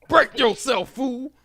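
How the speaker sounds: high, loud hammed-up threat; mock tough guy. Host jokingly threatens the friend with a loud, high mock threat. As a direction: play a robber shouting a joke threat.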